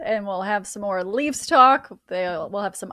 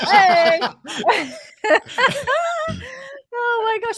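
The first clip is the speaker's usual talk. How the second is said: laughing; 12 semitones higher, 5 dB louder, slower than usual. Real, not acted. Reacting to the singing.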